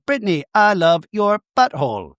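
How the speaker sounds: high stuffed-toy voice; talking stuffed animal. Pretend. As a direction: play a cuddly toy declaring its love. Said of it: "if these stuffed animals can talk".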